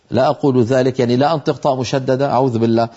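ordinary talk

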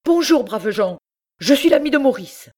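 loud and high, a cheerful shout from the doorway; calling out loudly. A narrator's reading. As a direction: call out so the whole room hears. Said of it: "en criant bien fort".

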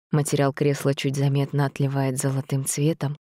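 ordinary delivery

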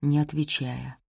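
ordinary delivery

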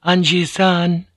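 high, drawn-out call. A narrator's reading. Fujiko calls Blackthorn back from the gate, high drawn-out call. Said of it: "Fujiko called out".

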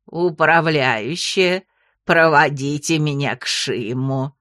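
low, slow croaking toad; gurgling frog voice. A narrator's reading. Frog character voice.

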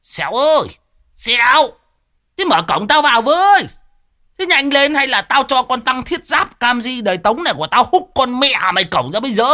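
high yelled demand at the gate; bawling at the gate. Acted. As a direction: holler to be let in, impatient. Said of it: "gào lên".

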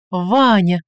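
high, loud cry of delight; overjoyed. A narrator's reading. Tsar wakes and sees Ivan, overjoyed. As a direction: burst out joyfully on seeing your rescuer. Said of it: "обрадовался царь".

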